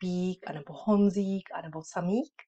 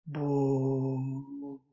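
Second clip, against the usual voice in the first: very slowly; 4 semitones lower than usual. Shown as a demonstration. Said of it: "Strašně pomaličku".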